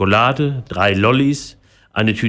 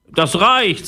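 high, sharp outburst; annoyed; 10 semitones higher than usual. A narrator's reading. Fed-up Philipp stops the parrot, annoyed outburst. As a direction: put a cross end to it. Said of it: "sagte er sauer".

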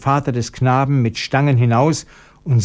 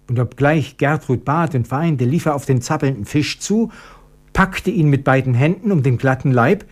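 usual speaking voice

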